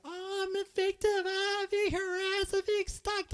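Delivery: high, mocking whine of a self-pitying victim; mock whiny victim. Streamer mocking the streamer's victim act, high whiny imitation. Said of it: "Anytime I hear her cry".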